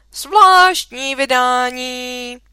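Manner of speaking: loud, long drawn-out street call; hawking a special edition, vowels stretched; newsboy's shout. Acted. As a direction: call it out slowly like a paper seller. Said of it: "To musíš utíkat a křičet".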